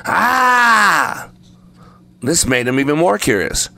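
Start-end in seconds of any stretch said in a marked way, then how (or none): 0.1-1.3 long high "Ah!"; echoed scream. A narrator's reading. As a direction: echo the scream back, high and drawn out. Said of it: "he heard a voice repeating".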